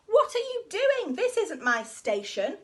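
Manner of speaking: loud outraged driver; indignant shout. Acted. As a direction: bellow it in outrage. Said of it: "shouted the driver with great indignation".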